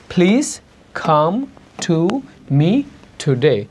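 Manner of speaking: "Please come to me today" word by word with breathy P, K, T; aspirated initial consonants. Shown as a demonstration. Teacher drilling one word at a time, aspirated consonants. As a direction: say "phlease, kham, thu", pausing between words. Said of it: "পরিবর্তন করে উচ্চারণ করতে হবে".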